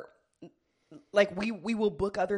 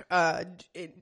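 ordinary talk, nothing marked

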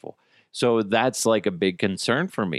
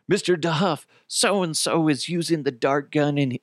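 higher-pitched imitation of a panicky kid; mock panic; 6 semitones higher than usual. Kid running to a friend's dad, mildly panicked. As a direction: report it like an alarmed child tattling. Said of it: "in, like, a kind of a panic".

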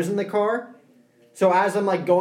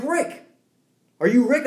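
higher questioning call of Rick; much higher questioning driver voice; driver calling again; 8 semitones higher than usual. Retold or imitated. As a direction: ask if he's Rick, pitched up. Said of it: "He's like".

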